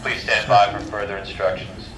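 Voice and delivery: normal talking voice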